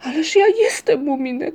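high, pleading outcry; acted wail of despair; despairing cry. A narrator's reading. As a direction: cry out desperately to be believed. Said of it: "krzyknął z rozpaczą".

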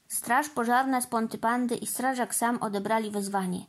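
usual speaking voice

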